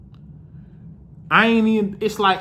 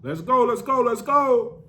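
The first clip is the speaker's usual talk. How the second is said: coach calling out; 10 semitones higher, slower than usual. Retold or imitated. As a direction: shout the team in like a coach. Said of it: "So Coach K like".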